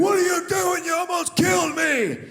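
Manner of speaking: high, loud furious shout; high shouted road rage; angry biker yell. Retold or imitated. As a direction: scream it like a biker you nearly hit. Biker yelling after near crash.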